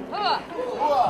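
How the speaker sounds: short, sharp Army grunt; barked hooah. Shown as a demonstration. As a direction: bark it like a soldier. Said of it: "I'm gonna teach you an Army word called hooah".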